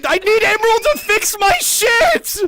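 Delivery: very high, loud complaint; strained high outburst; exasperated shouting. Real, not acted. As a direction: yell it in exasperation. Exasperated outburst over broken model.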